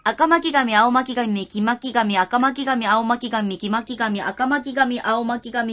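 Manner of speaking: 赤巻紙 run together without pauses; all in one breath. Shown as a demonstration. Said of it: "一息で三回言っちゃった方がいいんじゃない".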